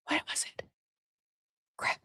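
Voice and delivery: whispered to herself; soft private whisper while thinking. Real, not acted. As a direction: breathe the question out under your breath. Hunting for lost note.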